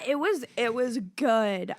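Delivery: usual speaking voice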